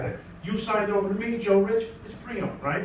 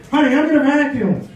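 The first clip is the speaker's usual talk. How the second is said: called out; 4 semitones higher, 5 dB louder than usual. Retold or imitated. Announces to wife, louder.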